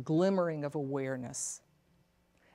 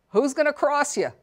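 father's gruff retort; 10 semitones higher, 8 dB louder than usual. Retold or imitated. Father's retort in childhood story.

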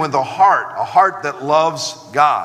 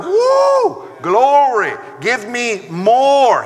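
joyful whoop; 6 semitones higher, much slower than usual. Retold or imitated. Boy tells the pastor he loves Bible class, whooping with joy. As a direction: burst out like a thrilled boy. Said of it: "He delighted in the law".

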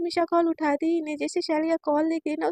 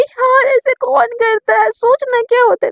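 exasperated whine; 7 semitones higher, 9 dB louder than usual. Retold or imitated. Girl protesting her crush's maybe, loud and exasperated. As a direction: complain in mock despair.

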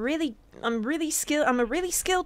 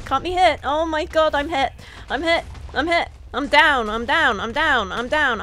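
panicked shouting; 2 semitones higher, 6 dB louder than usual. Real, not acted. Getting shot in the game.

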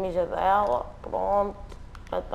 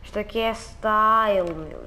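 normal talking voice

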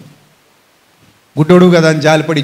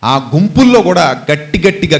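usual speaking voice

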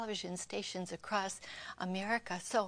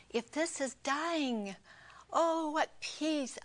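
awed peaceful sigh; 8 semitones higher, 4 dB louder, slower than usual. Retold or imitated. Retelling leaving her body in peace.

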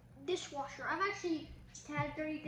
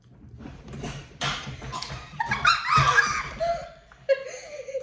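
high burst of laughing; laughing hard; loud laughter; 14 semitones higher, 9 dB louder than usual. Real, not acted. Big laugh during bean game.

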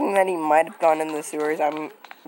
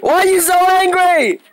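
high, loud shouted question; yelling back; 10 semitones higher, 7 dB louder than usual. Real, not acted. Player answering an angry friend, yelling back.

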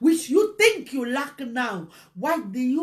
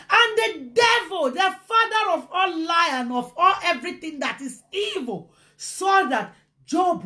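high, loud denunciation from the pulpit; ringing, raised sermon voice; fiery preaching; 6 semitones higher, 4 dB louder than usual. Real, not acted. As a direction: launch in hard, preacher at full force. Preaches at full force.

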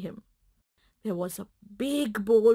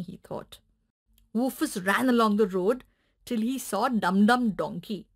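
usual speaking voice

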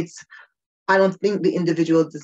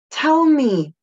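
pleading plea; 8 semitones higher, 5 dB louder than usual. Acted. Pleading to be told directly.

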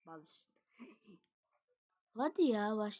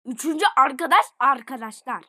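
louder excited repeat; 9 dB louder than usual. Real, not acted.

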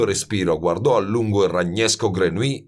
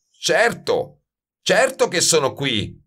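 understated bellow, higher pitch; 9 semitones higher, slower than usual. A narrator's reading. Baldini to Grenouille about the aromas, mildly bellowing. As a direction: roar back impatiently, held in. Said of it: "muggì Baldini".

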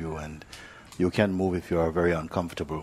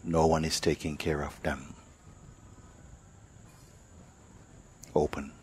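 guided meditation; 4 dB quieter than usual. Acted. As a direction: ease them into stillness, one phrase at a time. Teacher leads silent meditation.

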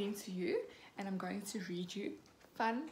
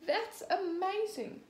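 higher, slow amazed cry; drawn-out amazement, higher; 9 semitones higher, slower than usual. A narrator's reading. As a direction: marvel at the win. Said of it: "cries Miss Rabbit".

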